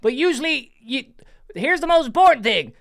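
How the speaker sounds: high, loud southern put-on voice; southern accent. Shown as a demonstration. Host teaching his trick to the caller, southern accent. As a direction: explain it in a big southern accent. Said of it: "the southern accent".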